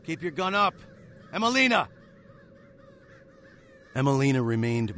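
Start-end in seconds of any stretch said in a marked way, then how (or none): 0.1-1.9 high, loud urgent yell; alarmed shout. Acted. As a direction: shout a warning across the clearing. Narrator yells warning to Emelina.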